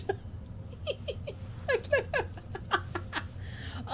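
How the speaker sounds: long wheezing laugh. Real, not acted.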